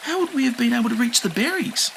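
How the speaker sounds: put-on high kind kid voice; reassuring. A narrator's reading. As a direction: cheer him up. Enki voice.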